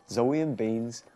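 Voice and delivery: put-on lively storybook reading for small children; bedtime story narration. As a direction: read it like a bedtime picture book.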